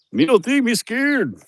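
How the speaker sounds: put-on broken-English Mexican accent, squeaked; imitating the worker's Mexican accent. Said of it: "one of those Mexican boys".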